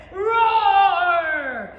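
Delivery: huge loud roar; bear roar. Acted. The bear roaring in class, loud growling roar. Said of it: "the bear said".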